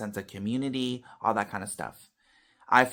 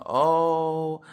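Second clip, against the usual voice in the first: drawn-out, higher imitation of grieving relatives; mock wailing; 6 semitones higher, much slower than usual. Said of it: "yelling and screaming and crying".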